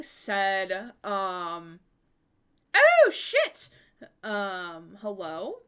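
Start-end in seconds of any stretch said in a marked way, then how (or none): 2.7-3.7 sudden high squeak of surprise; high startled oh shit; startled yelp. Real, not acted. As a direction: caught off guard, yelp it. Startled by sudden stream effect.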